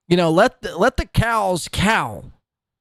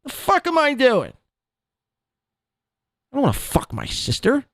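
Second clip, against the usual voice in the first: mock realization; 7 semitones higher than usual. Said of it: "be like".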